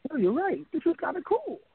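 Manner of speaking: imitating a boss pleasantly surprised; retold pleased realization. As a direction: come round to it in a high voice. Retold boss won over, delighted.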